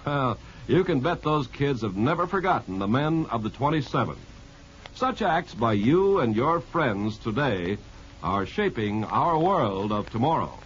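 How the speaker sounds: ordinary delivery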